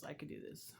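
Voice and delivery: whispering it to herself, fading out; a last whispered try at courage. Retold or imitated. Sick at work, whispering encouragement to herself. Self-talk pep, repeated in whisper.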